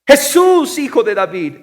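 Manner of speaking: shouted name, then easing down; loud cry that drops at the end. Acted. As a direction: call out high and loud, falling away. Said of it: "gritando y diciendo".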